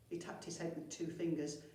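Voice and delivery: plain reading voice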